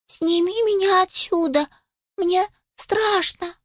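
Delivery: high, pleading kitten squeal; plaintive squeak. A narrator's reading. Kitten begs puppy to get him down, plaintively. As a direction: beg in a small scared kitten voice. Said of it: "жалобно запищал котёнок".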